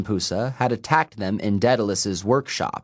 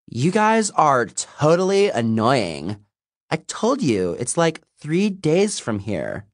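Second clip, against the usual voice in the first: put-on exasperated teen girl; 6 semitones higher than usual. A narrator's reading. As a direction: sigh it at annoying friends. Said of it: "a teenaged mortal girl getting exasperated".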